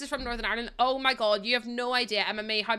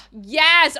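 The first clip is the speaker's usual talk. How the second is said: sudden loud burst of agreement; eager shout of agreement; excited yes; 5 semitones higher, 13 dB louder than usual. Real, not acted. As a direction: blurt it out, delighted.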